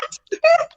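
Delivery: high cracked shriek sound; shrieking cry. Retold or imitated. Mom reacting to the news, shrieking. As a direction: let out a shrill shocked shriek.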